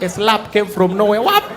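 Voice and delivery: normal talking voice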